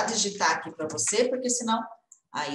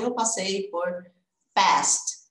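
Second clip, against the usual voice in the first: ordinary delivery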